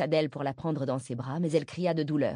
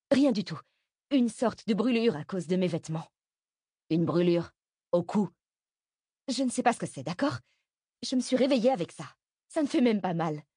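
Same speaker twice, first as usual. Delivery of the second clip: higher-pitched, evasive mother's voice; defensive mother; 4 semitones higher than usual. A narrator's reading. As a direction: brush off the question, pitch raised. (mère sur la défensive, voix aiguë)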